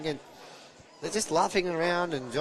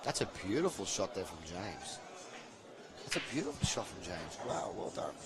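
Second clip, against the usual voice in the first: soft, low commentary during the shot; hushed play-by-play; 3 semitones lower, 11 dB quieter than usual. Real, not acted. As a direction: keep it down while the player shoots.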